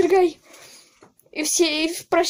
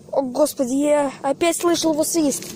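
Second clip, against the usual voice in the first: ordinary talk, nothing marked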